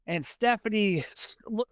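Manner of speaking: ordinary talk, nothing marked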